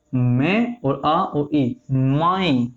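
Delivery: drawn out sound by sound, very slow; slow spelling out. Shown as a demonstration. Teacher breaking the diphthong into sounds, slowly. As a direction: spell each vowel out slowly. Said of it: "इस तरीके से हो जाएगा".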